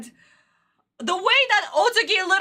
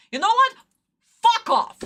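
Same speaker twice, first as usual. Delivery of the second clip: louder, higher, real irritation; angry brush-off; 8 semitones higher, 3 dB louder than usual. Real, not acted. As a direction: tell them off for real. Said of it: "I'm mad right now".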